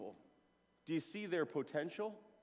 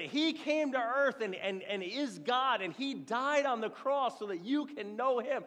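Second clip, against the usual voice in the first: mock street preaching; 8 semitones higher, 4 dB louder than usual. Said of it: "I start preaching".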